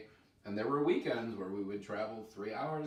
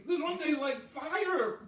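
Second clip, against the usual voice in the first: laughing in pain; 13 semitones higher, 4 dB louder, slower than usual. Real, not acted. Burning sauce, laughing.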